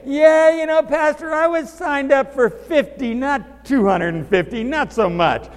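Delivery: high, loud mock complaint from a volunteer; mock Ellen quitting. Pastor mimicking Ellen balking at two hundred fifty kids, hammed up and high. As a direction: ham up a volunteer backing out.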